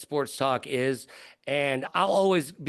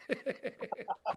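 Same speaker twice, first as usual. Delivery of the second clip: a soft real laugh after the punchline; 6 semitones higher, 11 dB quieter than usual. Real, not acted. Guest laughing at his own punchline, soft real laugh. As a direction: let a quiet laugh out.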